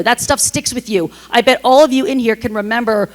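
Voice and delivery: ordinary delivery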